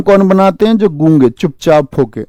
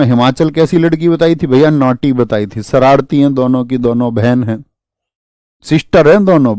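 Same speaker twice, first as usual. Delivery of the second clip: normal talking voice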